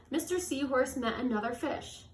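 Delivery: plain reading voice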